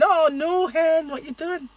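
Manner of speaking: high Scottish voice of a dismayed Scot; mock Scottish dismay, slow; imitating a Scottish viewer; Scottish accent. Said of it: "All my Scots ... going".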